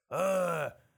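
loud drawn-out roar; predatory growl. Acted. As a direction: roar like a dinosaur. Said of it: "хищно зарычал он".

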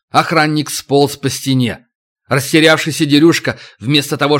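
plain reading voice

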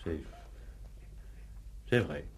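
aveu bas et plus doux, abattu; céder et avouer à voix basse; aveu accablé. Acted. Accusé avoue à contrecœur. (aveu accablé et bas)

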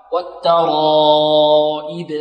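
والترائب with the long aa held on one note; slow, sustained aa in والترائب; four-count madd. Shown as a demonstration. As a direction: chant والترائب, stretching the aa before the hamza. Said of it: "أربع حركات".

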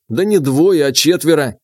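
ordinary delivery, nothing marked

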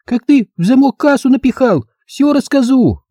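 put-on high, frantic girlish protest; squealing little girl. Girl threatens to tell everyone, high squealing child voice.

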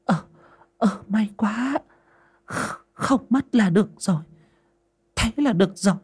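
halting, high-pitched relief; relieved sigh. A narrator's reading. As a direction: let the worry drain out. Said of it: "thở phào".

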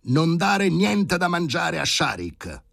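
high, loud, drawn-out shout; slow, stretched yell at high pitch; thundering order. A narrator's reading. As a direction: bellow the order from another room. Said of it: "tuonò l'ordine dall'ambulatorio".

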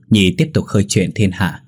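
ordinary delivery, nothing marked

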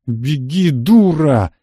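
insistent bark, rising then hitting hard; barked order. A narrator's reading. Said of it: "рявкнул я".

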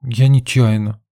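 high, slow, lightly voiced girl; apologetic girl. A narrator's reading. Girl apologises to her companion. (виновато, высоким девичьим голоском)

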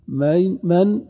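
normal talking voice

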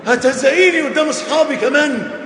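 loud, high, offended shout; a husband raging that his pride is hurt; angry husband. Acted. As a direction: blow up like a wounded husband in front of his friends. Preacher acts out an angry husband.

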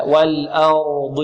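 slow, low model recitation of "والأرض"; light hamza, heavy ra. Shown as a demonstration. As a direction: stretch "والأرض" with thin hamza and full dad. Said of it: "نعم، هكذا". ("والأرض" بتأنٍّ وترقيق الهمزة)

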